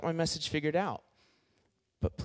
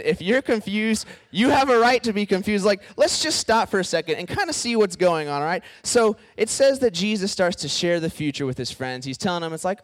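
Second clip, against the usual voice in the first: normal talking voice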